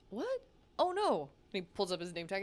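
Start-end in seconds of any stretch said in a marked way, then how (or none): 0.1-1.3 high-pitched, puzzled reaction; higher voice, mock bafflement; taken-aback guy. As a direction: lightly act out a surprised denial. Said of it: "And the guy goes".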